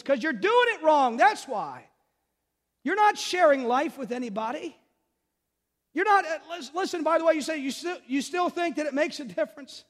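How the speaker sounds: loud, high pulpit push; loud impassioned sermon voice; fiery preaching. Acted. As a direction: drive the point home hard. Preaching at full force.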